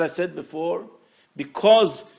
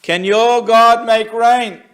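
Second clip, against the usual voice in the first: loud, slow, higher challenging call; movie quote; 6 semitones higher, 12 dB louder, slower than usual. Retold or imitated. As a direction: boom it out as a defiant challenge. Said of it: "Like the famous movie in Frisco Kid".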